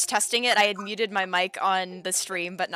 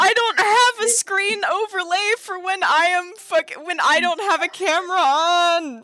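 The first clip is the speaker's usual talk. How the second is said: flustered laughing; 9 semitones higher, 6 dB louder, slower than usual. Real, not acted. Embarrassed after mic mishap.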